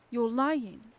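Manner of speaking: "lying" hit loud and falling; tearful outburst. A narrator's reading. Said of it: "Tom's voice was all wobbly from weeping".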